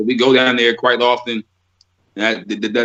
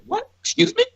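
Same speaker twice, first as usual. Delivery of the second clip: mock confusion.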